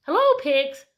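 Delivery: high, loud friendly duck; show-off duck calling to the pigs; cheery duck greeting. Acted. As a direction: call out hello like a proud duck. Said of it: "said Duck".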